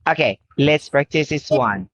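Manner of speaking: ordinary talk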